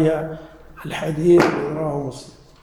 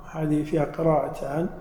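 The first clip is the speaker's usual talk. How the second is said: usual speaking voice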